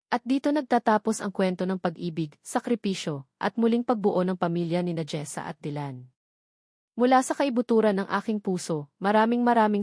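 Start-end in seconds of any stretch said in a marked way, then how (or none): none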